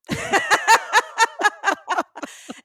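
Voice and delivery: loud, high burst of laughter; big laugh. Real, not acted. Mother cracking up at the waitress's question, real loud laugh. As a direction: crack up loudly at your own story.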